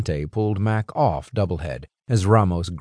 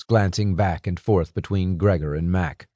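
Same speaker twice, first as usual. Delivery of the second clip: ordinary delivery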